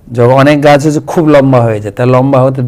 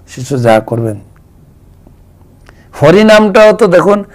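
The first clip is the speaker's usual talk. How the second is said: normal talking voice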